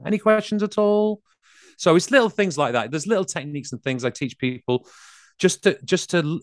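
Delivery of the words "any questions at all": high rising any questions; uptalk. Shown as a demonstration. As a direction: lift "Any questions at all?" up high at the end. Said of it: "completely different vibe from".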